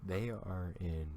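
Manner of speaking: ordinary delivery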